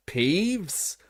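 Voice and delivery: stern teacher yelling at a poltergeist; angry yell. A narrator's reading. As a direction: call him out sharply from across the hall. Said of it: "yelled an angry voice".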